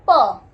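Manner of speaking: प said loud and punchy; emphatic sound. Shown as a demonstration. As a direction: pop the sound प out loudly. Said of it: "पी का हिंदी क्या होता है?". (प को ज़ोर से बोलना)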